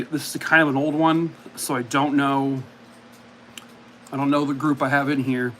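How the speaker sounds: ordinary talk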